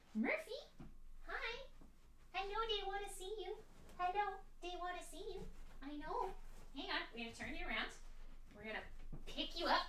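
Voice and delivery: high, soft cooing pet voice. Real, not acted. Cooing at dog on camera.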